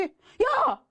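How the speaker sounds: sharp high call of 야; mock startled shout; retold yell. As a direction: friend yelling to wake someone. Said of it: "야 부르기 쉽지 않잖아요".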